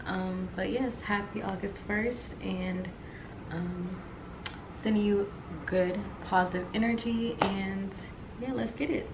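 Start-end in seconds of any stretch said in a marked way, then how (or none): none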